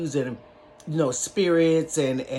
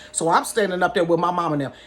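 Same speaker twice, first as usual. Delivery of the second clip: ordinary delivery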